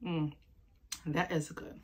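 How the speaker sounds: quiet mouthful taste verdict; tasting, mouth full. Real, not acted. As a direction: talk round a sip of soda. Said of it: "taste test".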